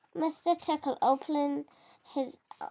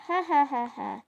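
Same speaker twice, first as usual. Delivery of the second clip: dragon's ha-ha laugh, fading away; roaring laugh. Acted. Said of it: "roared the dragon".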